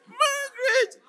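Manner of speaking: very high, slow, drawn-out shout of her name; joyful shout. Acted. Prisoner hearing of release, joyful shout. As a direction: yell her name for joy. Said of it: "the guy was shouting".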